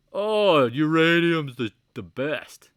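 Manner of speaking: loud, slow mocking voice of a uranium fan; sarcastic hype voice. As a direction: ham up the excited believer.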